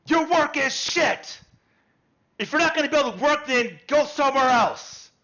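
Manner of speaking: high, loud furious shouting at workers; angry yelling man. Acted. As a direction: yell at the smith in a rage.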